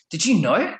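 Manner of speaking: mock-astonished, pitch jumping up; incredulous question. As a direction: imitate a stunned mate asking. Friend's astonished question, retold.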